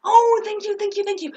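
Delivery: high, loud string of thanks; effusive mock thanks. Two actors finally get the signal.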